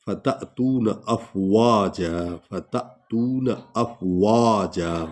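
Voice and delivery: very slow, the waw of अफ़वाजा drawn out; long waw. Shown as a demonstration. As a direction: stretch the waw in अफ़वाजा before the alif. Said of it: "वा को लंबा करेंगे". (अफ़वाजा में वा लंबा)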